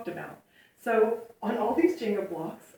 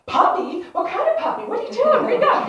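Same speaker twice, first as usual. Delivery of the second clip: put-on much higher, loud and tumbling, like thrilled boys; eager excited kids; 13 semitones higher, 9 dB louder than usual. Subway boys pepper lady about her puppy, excited high. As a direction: squeal with sudden curiosity.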